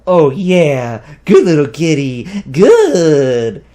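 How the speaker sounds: put-on booming doting baby talk; loud gushing praise. A narrator's reading. Viet doting on the friendly cat, loud. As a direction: praise the kitty, loud and delighted.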